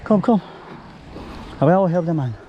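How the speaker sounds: normal talking voice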